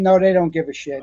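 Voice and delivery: normal talking voice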